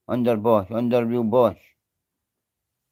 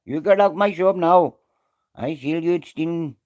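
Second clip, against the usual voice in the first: a lightly put-on Indian accent, higher; hammed-up Indian shopkeeper accusing, a touch higher; mock shopkeeper accent; 5 semitones higher than usual. Mocking a shopkeeper catching a thief, lightly put-on Indian accent.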